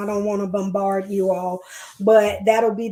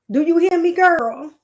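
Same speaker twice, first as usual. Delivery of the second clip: louder, higher call of a loving grandma; grandma's affectionate call, a bit louder and higher; imitating grandma; 7 semitones higher, 4 dB louder than usual. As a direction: call out like grandma checking you're listening. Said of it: "That's how she would say it".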